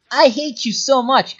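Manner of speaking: loud, high, slow and vehement; exasperated emphasis. Real, not acted. Telling the maddening player off, exasperated and emphatic. Repeats line with more force.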